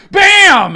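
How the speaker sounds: loud, high shouted bam. Pretend. Telling the fall of Lucifer, loud shouted sound effect. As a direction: slam it like a door shutting.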